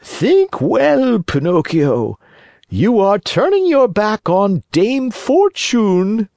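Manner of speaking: high, slow, sly fox; sly fox warning. Acted. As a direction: warn him slowly, sweetly threatening. Fox persuades.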